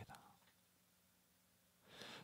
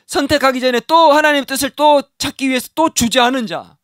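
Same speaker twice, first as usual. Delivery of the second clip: impassioned preaching; 8 semitones higher, 5 dB louder than usual. Acted. Preacher stressing a repeated asking to the congregation, impassioned. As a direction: preach it out, building fervor.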